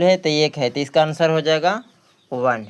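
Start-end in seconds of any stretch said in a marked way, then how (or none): none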